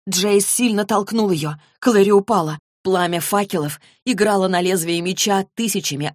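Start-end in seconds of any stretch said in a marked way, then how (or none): none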